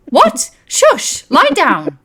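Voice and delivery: high, loud scolding shout; scolding auntie. Acted. Auntie overhearing child at bedtime, loud high scolding. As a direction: shriek orders to settle down.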